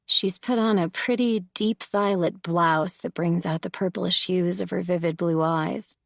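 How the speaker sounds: ordinary delivery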